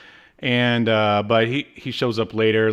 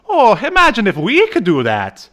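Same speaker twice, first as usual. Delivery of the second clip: higher, louder playful Irish Dolph voice; mock Irish; 9 semitones higher, 8 dB louder than usual. Host quoting Dolph to co-host, mock Irish. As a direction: toss off an Irish cartoon voice.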